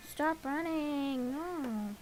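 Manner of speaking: ordinary delivery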